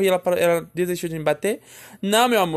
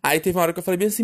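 usual speaking voice

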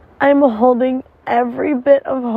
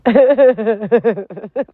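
rising giggle. Real, not acted. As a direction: giggle up at own joke. Laughing at own joke.

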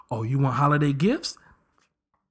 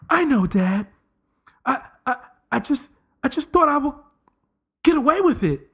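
higher, rising whiny confession; sheepish boy owning up to dad; sitcom son; 9 semitones higher than usual. Pretend. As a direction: kid stammering excuses, pitch climbing.

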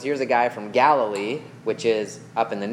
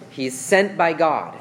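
ordinary talk

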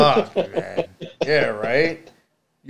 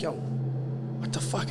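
scared whisper; 2 semitones higher, 9 dB quieter, much slower than usual. Retold or imitated. Scared alone hearing footsteps.

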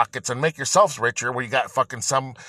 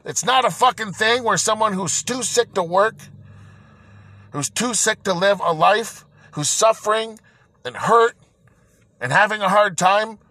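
higher-pitched, heated rant; real anger; 6 semitones higher than usual. Real, not acted. Venting to podcast listeners about being sick and stuck, real anger. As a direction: let the frustration boil over, pitched up. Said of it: "I'm a little angry".

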